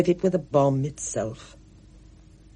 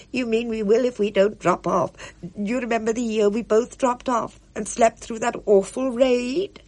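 put-on higher, fluttery old lady; eager Pinky; 7 semitones higher than usual. A narrator's reading. Pinky fusses so Spud need not move, fluttery. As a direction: bustle about, keen to help.

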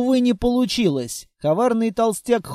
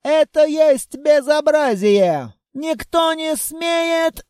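wailing pompous foreigner; 6 semitones higher, slower than usual. A narrator's reading. Толстяк улетает в портал и вопит, громко и высоко. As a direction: howl the complaint as he flies back.

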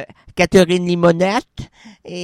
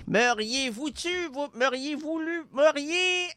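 spirit voice; 8 semitones higher, 10 dB quieter than usual. Acted. Spirit voice answers softly.

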